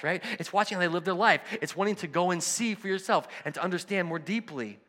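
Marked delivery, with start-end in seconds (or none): none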